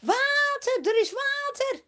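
very high, loud cry of discovery; excited shout. Acted. Next traveller shouting to the crowd about water. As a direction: yell the good news back down the line. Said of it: "En de volgende erachteraan".

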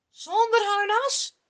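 high, loud, disbelieving question; verbaasde vraag. Acted. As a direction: shoot it up in disbelief. Saul verbaasd over plan. (verbaasd en luid: zonder harnas?)

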